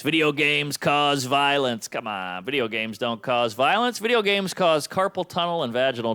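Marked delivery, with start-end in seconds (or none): 0.0-1.9 mocking slogan. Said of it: "they're blaming the video games".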